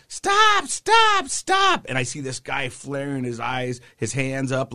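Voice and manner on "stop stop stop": very high, loud imitated cry for help; panicked lady shouting. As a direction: shriek "stop" like a frantic bystander. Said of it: "I see this lady going".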